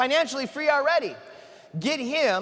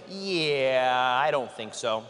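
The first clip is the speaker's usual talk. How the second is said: much lower, dragged-out "yeah", flat no; deep stubborn refusal; 11 semitones lower, much slower than usual. Retold or imitated. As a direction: play Mark flatly refusing in a low voice. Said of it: "he actually went like this".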